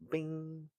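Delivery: high, held ringing "bing"; imitated arrow twang. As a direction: sing out the sound effect on one high note.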